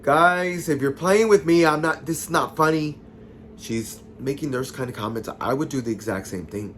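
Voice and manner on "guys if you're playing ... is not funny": louder, higher retold protest; acted-out "not amused" call; annoyed warning. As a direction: call out to pranking friends, fed up. Retold warning to pranking friends.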